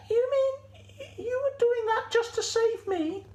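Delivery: very high, halting sheep voice; amazed sheep. Acted. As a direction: ask haltingly in a high squeak. Sheep realises goat saved her.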